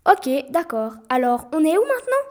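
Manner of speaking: put-on loud, rising, exasperated boy; loud annoyed question; indignant outburst. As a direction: protest at being lost. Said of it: "se révolta le garçon".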